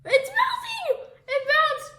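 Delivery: very high laughing shriek; laughing shout. Real, not acted. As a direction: shriek with delight at the bubble.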